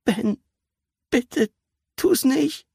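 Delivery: very high, halting plea; desperate, frightened appeal; pleading. A narrator's reading. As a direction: beg him with pauses between words. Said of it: "schaute den Kleinen flehend an".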